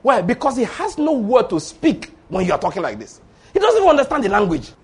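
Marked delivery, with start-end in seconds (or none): none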